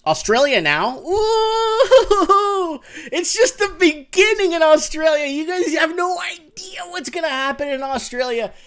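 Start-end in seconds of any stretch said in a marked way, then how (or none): 1.0-8.5 high and loud, gleeful; excited. Real, not acted. Vlogger hyping his prediction to viewers, high and excited. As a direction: crow it like you called it first. Excited rant about Australia.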